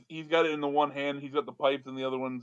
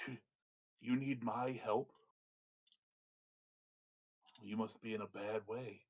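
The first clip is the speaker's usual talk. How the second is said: quiet mocking wizard; 3 semitones lower, 12 dB quieter than usual. Wizard mocks the plea for help.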